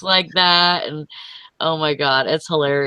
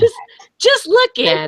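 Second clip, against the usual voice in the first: high-pitched comic nagging catchphrase; Ed Bassmaster. Acted. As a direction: repeat it in a very high put-on voice. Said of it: "like, Ed Bassmaster voice".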